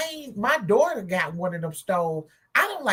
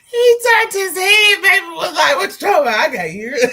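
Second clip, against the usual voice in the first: loud, high, laughing outburst; hollering the retelling, tickled to bits; cracking up; 8 semitones higher, 8 dB louder than usual. Real, not acted. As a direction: shout it through real laughter. Cracking up while retelling the joke.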